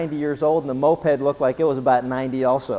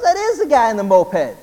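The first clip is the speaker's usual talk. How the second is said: higher and louder in disbelief; surprised; 7 semitones higher, 5 dB louder than usual. Retold or imitated. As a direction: realize it's really him, amazed. Young driver shocked in joke.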